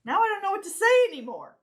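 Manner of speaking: a laughing, self-conscious outburst; flustered. Real, not acted. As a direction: blurt it out in embarrassed panic. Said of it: "I'm all self-conscious".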